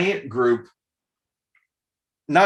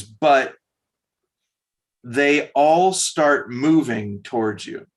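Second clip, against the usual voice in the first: usual speaking voice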